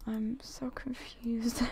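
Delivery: confused whisper; hushed, bewildered aside. Real, not acted. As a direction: breathe it out, baffled. Baffled by odd baby shower.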